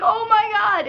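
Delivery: loud, high mock shock; retold startled cry. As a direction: exclaim it like a startled driver. Old lady's startled reaction, acted.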